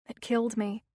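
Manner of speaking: usual speaking voice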